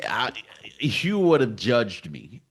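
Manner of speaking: ordinary delivery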